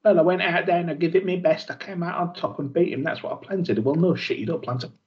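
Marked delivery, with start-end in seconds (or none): 0.0-3.8 higher, hammed-up wrestler answer; mock wrestler voice. Mocking wrestler's interview to cohosts, higher hammed-up voice. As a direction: parody a smug wrestler interview, pitched up.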